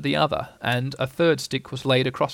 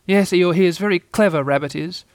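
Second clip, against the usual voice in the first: slightly higher small voice; timid Piglet; 5 semitones higher, 5 dB louder than usual. A narrator's reading. Answering Eeyore, a lightly timid Piglet. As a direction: answer meekly like a little pig.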